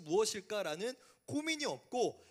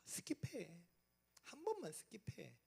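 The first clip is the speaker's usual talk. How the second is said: hushed, coaxing devil voice; a tempter coaxing a student to give in; tempter's whisper; 11 dB quieter than usual. Acted. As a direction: whisper the bait, soft and sly. Tempter voice urging skip.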